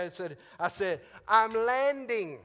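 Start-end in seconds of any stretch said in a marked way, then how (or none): 1.3-2.4 loud, high, drawn-out announcement; acted radio shout. Pilot radioing the airport that he is coming in, shouting. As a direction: blurt it out loud and stretched.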